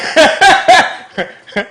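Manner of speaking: loud, high laugh; high burst of laughter. Real, not acted. Laughing at Tristan remark.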